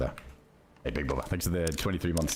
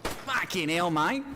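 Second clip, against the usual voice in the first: British bloke voice; 9 semitones higher than usual. Pretend. Gamer hamming up a British accent for his teammate. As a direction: play a rowdy British lad with a mate in every line.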